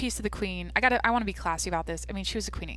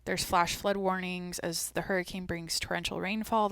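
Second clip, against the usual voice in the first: normal talking voice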